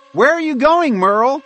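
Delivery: high-pitched, loud yell to a friend; high squirrel character yelling out. A narrator's reading. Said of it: "Bob yelled".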